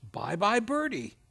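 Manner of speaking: ordinary talk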